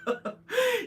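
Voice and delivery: high squeal of a laugh; a sudden burst of laughter at the mom's line. Real, not acted. Vlogger recapping a reality show, cracking up.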